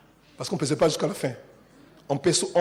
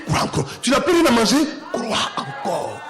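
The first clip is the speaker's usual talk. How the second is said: preaching building; 6 semitones higher, 5 dB louder than usual. Acted. Preacher rousing the church over music, building call and answer.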